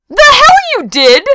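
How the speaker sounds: high, loud outraged retort; indignant squawk. A narrator's reading. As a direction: burst out in protest. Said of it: "Pat squawked".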